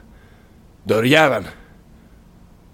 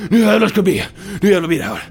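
battle cry; 11 semitones higher, 5 dB louder, faster than usual. Acted. Psyching himself up for battle.

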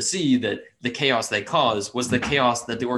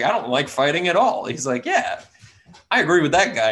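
higher, eager, louder gnome voice; eager agreement; 5 semitones higher, 4 dB louder than usual. Retold or imitated.

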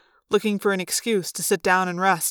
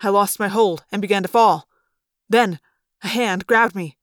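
plain reading voice

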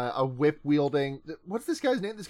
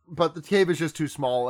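normal talking voice

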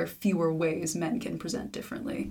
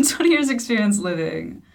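laughing through it, louder and higher; 5 semitones higher, 8 dB louder than usual. Pretend. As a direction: laugh out the words. Laughing joke take.

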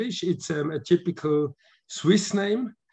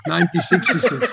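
laughing; 2 semitones higher, 5 dB louder than usual. Real, not acted. Laughing at own slip.

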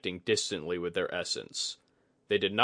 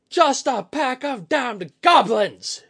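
high, slow, full-throated warrior roar; 11 dB louder, slower than usual. Acted. Commander spotting goblins across the river, roaring. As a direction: roar it out, stretching the words. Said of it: "he roared".

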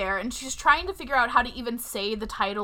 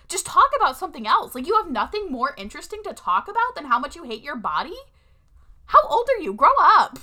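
loud, high, exasperated rant; fed-up rant; 6 semitones higher, 6 dB louder than usual. Real, not acted. Ranting at the film's body talk, loud and high. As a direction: scold them, really fed up. Ranting about women hating bodies.

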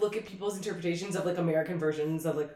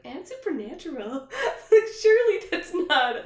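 high-pitched incredulous retelling; scoffing in a raised, amused voice; mock disbelief; 14 semitones higher, 5 dB louder, slower than usual. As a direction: recount it in laughing, high disbelief. Retelling own disbelieving reaction.

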